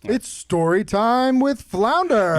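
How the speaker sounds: slow, drawn-out, loud show intro; big slow segment announcement; announcer intro. Acted. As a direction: announce it like a kids' TV host.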